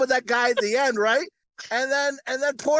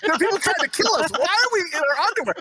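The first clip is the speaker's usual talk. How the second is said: high, playful disbelief; hammed-up confusion; mock incredulous; 6 semitones higher, faster than usual. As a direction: echo the question in exaggerated bafflement. Host echoes the baffled question.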